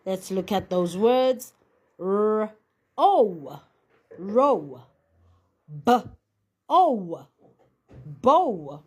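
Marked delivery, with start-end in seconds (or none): 2.0-4.8 R-O sounded out, then row blended. Shown as a demonstration. Teacher models sounding out the word for pupils, slow and clear. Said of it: "And now we change the sound to ow". 5.8-8.8 B-O sounded out, then bow said with a long O; sound out then blend. Shown as a demonstration. Teacher models the long O word for the class, clear and deliberate. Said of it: "it can sound like O".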